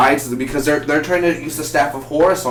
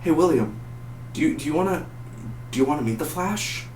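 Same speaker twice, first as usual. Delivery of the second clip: higher, soft, coaxing offer; hesitate, then ask gently in a raised pitch; Oliver; 4 semitones higher, 6 dB quieter than usual. Retold or imitated. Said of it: "I want Oliver to be like".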